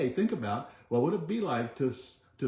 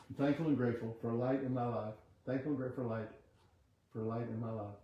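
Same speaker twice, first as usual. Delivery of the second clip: quiet affirmation; 6 dB quieter than usual. Acted. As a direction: murmur the affirmation like a meditation. Leads a chanted affirmation.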